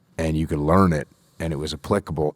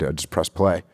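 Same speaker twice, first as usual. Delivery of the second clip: usual speaking voice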